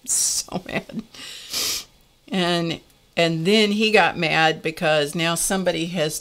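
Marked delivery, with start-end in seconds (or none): 0.0-1.0 slow, unvoiced, breaking into a laugh; half-whispered laugh through it; hushed giggle. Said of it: "I'm crying".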